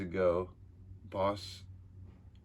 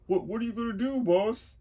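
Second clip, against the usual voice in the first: high, loud timid henchman voice; nervous henchman; 13 semitones higher, 6 dB louder than usual. Acted. Henchman timidly asking the boss his plan. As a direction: squeak the question up at the boss.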